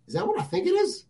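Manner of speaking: high, incredulous question; mock shock at the jersey; retold disbelief. Parent seeing the bus driver's Cowboys jersey, incredulous. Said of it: "I was like".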